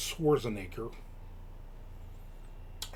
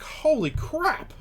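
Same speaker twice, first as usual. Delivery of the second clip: high, slowed holy crap; amazed; 13 semitones higher, much slower than usual. Retold or imitated. Reacting to a dozen zeppole arriving, amazed. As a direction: marvel at the pile of donuts.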